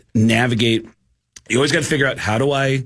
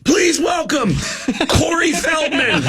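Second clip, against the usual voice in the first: high, shouted stage introduction; 13 semitones higher than usual. Retold or imitated. Rock singer announcing a letdown guest, high shout. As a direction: hype the next act, stretching the name. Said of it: "And then [name] goes".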